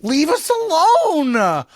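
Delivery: mock outburst at the politician who won't stop; exasperated shout. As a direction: blow up at her, done with it. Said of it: "And you're like".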